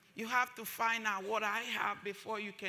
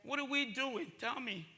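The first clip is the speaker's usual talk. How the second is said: ordinary talk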